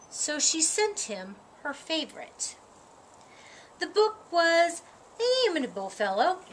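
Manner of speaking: usual speaking voice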